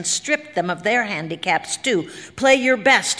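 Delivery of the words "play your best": higher, emphatic instruction; emperor's order. A narrator's reading. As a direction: urge the musicians on with authority. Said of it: "he told them".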